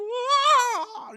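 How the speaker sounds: long, high, loud drawn-out howl of grief; mourning aunt's drawn-out wail; anguished wail. Retold or imitated. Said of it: "이렇게 하는데".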